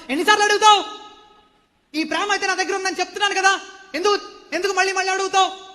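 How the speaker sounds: loud, high exasperated protest; irritated Peter. Acted. Peter, angry at being asked again, shouts back. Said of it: "పేతురికి కోపం వచ్చిందంట".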